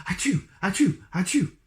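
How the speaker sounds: three high, loud acted sneezes. A narrator's reading. Mister Sneeze sneezing three times for the magic, high and loud. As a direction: fire off three big achoos. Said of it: "sneezed Mister Sneeze".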